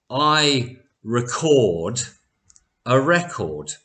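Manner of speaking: slowly, first "record" re-CORD, second "record" REC-ord; verb and noun stress. Shown as a demonstration. Teacher shows word order to learners, "record" re-CORD then REC-ord. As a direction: space it out, shifting stress in "record" from end to front. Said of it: "is going to be a verb".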